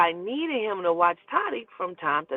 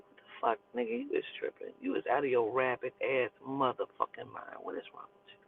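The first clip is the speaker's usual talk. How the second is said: soft, breathless whispered outrage; whispered cursing; 6 semitones lower, 10 dB quieter than usual. Retold or imitated. After hanging up on her father, whispered disgust. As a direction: whisper it, appalled.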